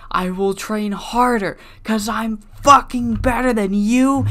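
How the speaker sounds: high, loud furious trash talk; furious shout. Retold or imitated. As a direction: yell it right in his face. Rival answers with heated promo.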